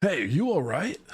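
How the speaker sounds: laid-back surfer dude voice. Acted. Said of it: "we're gonna go with the surfer voice".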